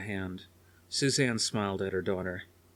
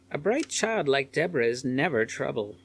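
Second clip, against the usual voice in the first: lightly voiced concerned old lady; 6 semitones higher, 4 dB louder than usual. A narrator's reading. Sitter reassures.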